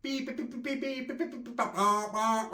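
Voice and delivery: high beeping like a metal detector. Retold or imitated. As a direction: beep like a detector going off. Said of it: "my chorra is a fat woman detector".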